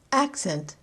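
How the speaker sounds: "Accent" as AC-cent, the noun; stress on first syllable. Shown as a demonstration. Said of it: "accent the first syllable of the noun".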